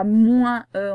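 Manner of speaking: "moins e" with the nasal "ouin" and a tacked-on e; wrong pronunciation. Shown as a demonstration. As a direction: say "moins e" nasal then an extra e. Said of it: "on ne dit pas".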